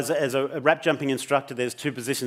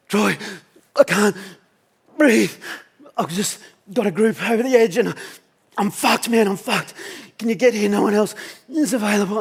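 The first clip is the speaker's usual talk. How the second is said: high, loud gasping phrases; breathless panic; 7 semitones higher, 5 dB louder than usual. Retold or imitated. Ill mate phoning Troy for help, breathless panic. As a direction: act out fighting for air, broken by pauses. Friend phones unable to breathe.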